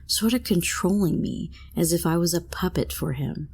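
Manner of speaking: ordinary delivery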